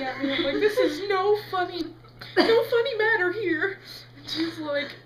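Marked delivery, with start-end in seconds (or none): 0.6-3.8 high, loud mock protest; hammed-up upset scolding; wailing indignation. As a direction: object tearfully, highly offended. Scolding the laughing lady.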